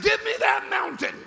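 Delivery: high-pitched preacher's shout; bold demand. Acted. Caleb demanding his mountain, bold high shout.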